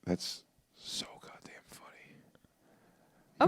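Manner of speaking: hushed whispered aside; whispering. Real, not acted. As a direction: whisper so the caller won't hear. Laughing aside on phone call.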